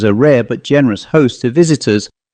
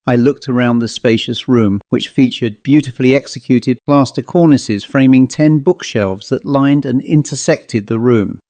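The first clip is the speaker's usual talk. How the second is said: ordinary delivery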